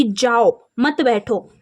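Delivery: जाओ set apart, pause, then मत बैठो together; pause after जाओ. Shown as a demonstration. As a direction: say जाओ, stop briefly, then join मत बैठो. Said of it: "इसका अर्थ है कि यहाँ पर कहा जा रहा है". (जाओ के बाद विराम, मत बैठो साथ में)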